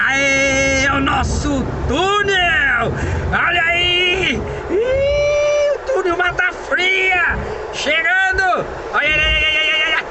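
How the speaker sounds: high, drawn-out hurrah; hyped-up announcing of an arrival; excited tunnel cheer. Real, not acted. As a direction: call it out with long, stretched vowels.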